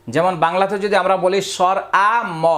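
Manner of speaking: ordinary delivery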